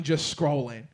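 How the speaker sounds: ordinary talk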